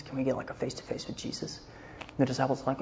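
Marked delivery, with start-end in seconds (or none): 0.0-1.6 quick, hushed whisper of a request. Retold or imitated. Greeks sneaking a request to the disciples, whispered. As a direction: ask on the sly, fast and under the breath.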